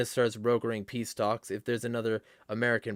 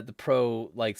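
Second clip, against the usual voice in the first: ordinary delivery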